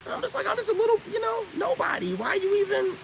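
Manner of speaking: playing the innocent little nobody in falsetto; mock falsetto innocence. Vlogger mocking his haters in a high sarcastic falsetto.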